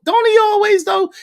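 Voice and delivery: squeaky falsetto tease; a mock high sing-song jab; teasing. Real, not acted. Host teasing that Anton always cooks, falsetto.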